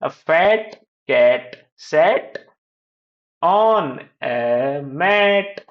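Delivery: very slow, word by word; repeat-after-me reading. Shown as a demonstration. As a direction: stretch each word with pauses between. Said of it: "फिर से repeat करें".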